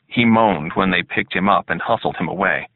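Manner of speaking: usual speaking voice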